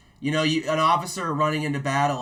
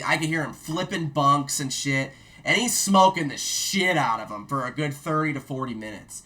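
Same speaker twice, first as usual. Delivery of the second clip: ordinary delivery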